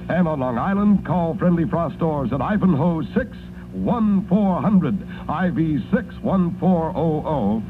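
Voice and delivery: upbeat radio pitchman read; chipper 1950s ad. Acted. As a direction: sell it cheerfully to the moms listening. Live radio ad for washer.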